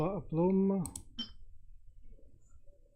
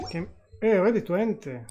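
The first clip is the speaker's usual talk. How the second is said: surprised; 5 semitones higher, 7 dB louder, faster than usual. Real, not acted. Artifact levels up badly.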